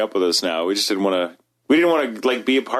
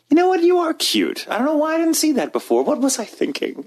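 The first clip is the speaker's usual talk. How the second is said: high-pitched mock revelation; exaggerated high swoon, easing down; sudden gushing realization. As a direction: act out being suddenly smitten, pitched way up. Acts sudden realization of cuteness.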